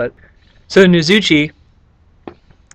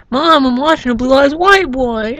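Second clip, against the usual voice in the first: put-on slow, pitched-up kid voice; mock kid answer; 10 semitones higher than usual. Gamer plays a kid answering his mom, slow and high. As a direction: drawl it like a sheepish kid. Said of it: "And it's like".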